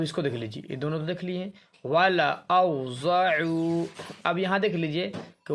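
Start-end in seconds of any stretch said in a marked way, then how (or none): 1.8-3.9 drawn-out recitation, alif left silent; slow tajweed recitation; Quranic recitation. Shown as a demonstration. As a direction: recite it slowly with the alif unspoken. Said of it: "silent है".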